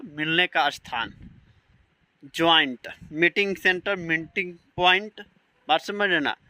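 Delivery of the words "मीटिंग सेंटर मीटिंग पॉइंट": ordinary talk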